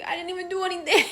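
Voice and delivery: high, fast, put-upon protest; imitating her younger self, high and quick; whiny protest. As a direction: whine your innocence quickly. Protesting to the arresting officer.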